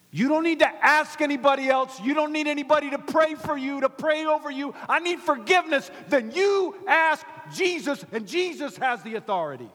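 loud, high proclamation; soaring, shouted sermon delivery; full-force preaching. Real, not acted. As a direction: proclaim it to the whole congregation.

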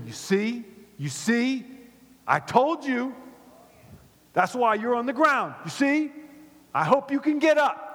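high, loud I-told-you-so; mock scolding. Family scolding someone who fell down, mock reproachful. Acted family blaming the fallen.

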